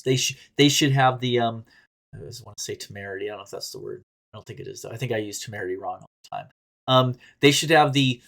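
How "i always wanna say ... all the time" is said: much softer, fast muttered aside; a quick, much softer aside. Real, not acted. Muttered aside about word choice.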